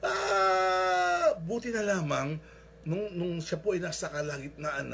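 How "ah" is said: long, high, loud "aaah"; falling man's scream. Retold or imitated. Man slips off the cliff, screaming all the way down. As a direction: scream like someone dropping off a cliff. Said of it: "sigaw po siya na sigaw".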